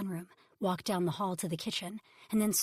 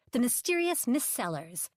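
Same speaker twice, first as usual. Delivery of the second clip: snooty; 5 semitones higher, 5 dB louder than usual. Acted. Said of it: "turning up her nose".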